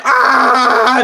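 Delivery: high, loud mock scream; imitated scream. Acting out the victim screaming under her, mock scream. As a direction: scream back in acted terror. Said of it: "you're just screaming".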